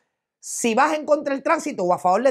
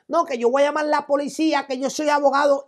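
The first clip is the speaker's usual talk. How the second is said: higher-pitched, quick mock protest; mimicked yelling; 6 semitones higher than usual. Driver insists he is a lawyer, mimicked yelling. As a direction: imitate the driver's indignant comeback, lightly. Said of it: "sigue gritando y sigue".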